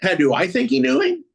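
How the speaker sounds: loud, high-pitched disbelieving question; incredulous echo. Real, not acted. Echoes cohost's question in disbelief.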